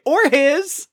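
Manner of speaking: sudden high Australian afterthought; abrupt Australian. Retold or imitated. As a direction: tack it on abruptly in an Aussie voice. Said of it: "very abruptly".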